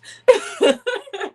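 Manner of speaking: laughing high at a slip-up. Real, not acted. Laughing at failed tongue twister.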